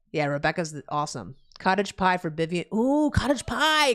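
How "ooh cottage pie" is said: slow, drawn-out delighted ooh; savoring ooh. Real, not acted. Streamer reacts to a chat message about cottage pie, delighted. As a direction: relish the thought of it.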